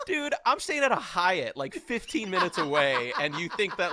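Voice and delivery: high, loud amused disbelief; incredulous protest. Retold or imitated. Storyteller answers impressed onlookers in his head, incredulous protest. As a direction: protest loudly, laughter creeping in.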